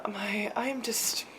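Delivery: whispered to herself; breathy whisper, frazzled. Real, not acted. Flustered, talking to herself.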